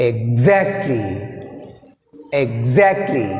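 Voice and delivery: "exactly" with a strong, drawn-out "xact"; stress on second syllable. Shown as a demonstration. Teacher showing stress in "exactly", second syllable stressed. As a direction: start low, punch "xact" in "exactly". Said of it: "That's why we take".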